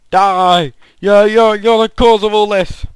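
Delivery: high, loud, hammed-up rage at a villager; mock furious shout. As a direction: blame the villager at full volume. Attacks villager in anger.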